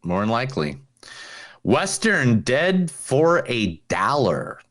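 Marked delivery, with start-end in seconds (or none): 1.6-4.6 slow dramatic title. Pretend.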